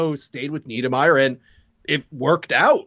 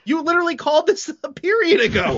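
high-pitched incredulous protest; indignant fan outburst. Retold or imitated. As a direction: protest a bad call in a squealed, exasperated voice. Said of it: "I'm legitimately going".

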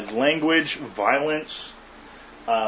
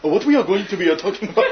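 put-on Japanese accent; 5 semitones higher, much faster than usual. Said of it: "Japanese guy!".

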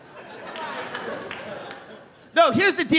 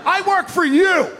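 aggressive mock yell; 6 semitones higher than usual. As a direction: bark it like a pushy salesman at a job interview. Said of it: "I'm like".

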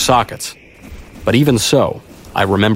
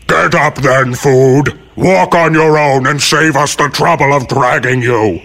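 raised, rumbling beast voice; forceful yeti command, pitched up; commanding great Yeti; 6 semitones higher, 4 dB louder than usual. Acted. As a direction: order the captive up as a huge creature. Great Yeti orders captive.

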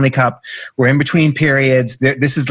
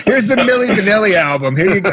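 laughing; 7 semitones higher than usual. Retold or imitated. Joking, laughing.

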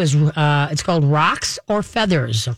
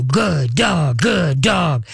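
punchy mock praise, each word falling; mock hearty dog-patter; much slower than usual. Host showing how owners pound dogs, mock hearty praise. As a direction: imitate someone heartily thumping a dog. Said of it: "pound on their sides and go".

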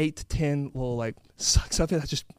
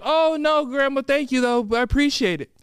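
polite refusal; 8 semitones higher, 3 dB louder than usual. Acted. Grandkids politely decline grandma.

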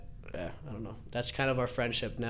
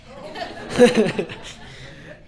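loud breathy laugh, higher; burst of laughter; 6 semitones higher, 5 dB louder than usual. Real, not acted. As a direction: crack up at the idea. Laughing at the prank idea.

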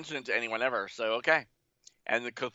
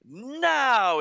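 slow, much higher, rising into now; drawn-out build-up; 11 semitones higher, 5 dB louder, much slower than usual. Acted. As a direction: stretch it out as the spell charges.

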